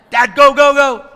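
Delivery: high-pitched, very loud yell; kids urging dad. Retold or imitated. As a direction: shout it urgently like kids in the back. Kids yelling to texting dad.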